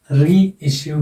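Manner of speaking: Reissue with a long, accented री; secondary stress on री. Shown as a demonstration. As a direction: hold री, then say issue in reissue. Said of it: "जब ये secondary accent लगेगा".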